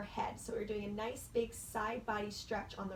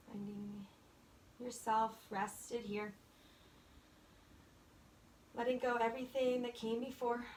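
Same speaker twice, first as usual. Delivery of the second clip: guided meditation. Acted. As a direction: ease them into stillness. Yoga teacher guiding settling in.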